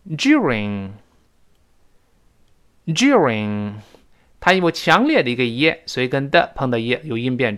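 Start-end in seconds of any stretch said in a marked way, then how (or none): 0.1-3.7 during very slow with a strong dyoo glide, British style. Shown as a demonstration. Teacher reading during the British way. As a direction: draw out the British dyoo sound. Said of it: "我们按照英国的发音再读一下".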